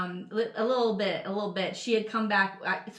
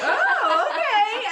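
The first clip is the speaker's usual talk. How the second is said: high, loud, laughing mock surprise; retold surprise; 13 semitones higher, 8 dB louder, much slower than usual. Host retelling being named before the crowd, surprised and laughing. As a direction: reenact being thrilled and taken aback.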